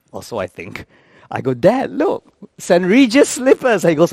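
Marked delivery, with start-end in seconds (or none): 1.5-3.8 higher, excited son showing off; eager presenting. Retold or imitated. Son showing his father hotel slippers, eager and high. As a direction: call out proudly to Dad.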